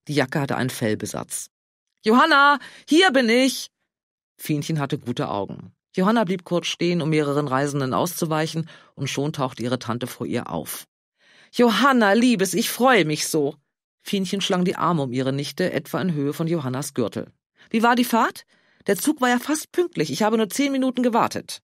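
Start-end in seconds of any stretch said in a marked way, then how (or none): 2.0-3.7 high, loud call across the platform. Acted. Aunt calling out across station. 11.5-13.6 delighted greeting. Acted. Aunt greets niece delightedly. 17.7-21.6 chatty aunt. Acted.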